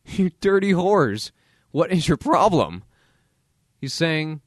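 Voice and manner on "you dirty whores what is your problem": mock insult. Real, not acted. Mocking outraged critics.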